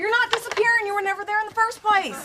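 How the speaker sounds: high, quick comeback in a quarrel; exasperated, rising-pitched rebuttal; heated retort. Acted. Wife snaps back in quarrel.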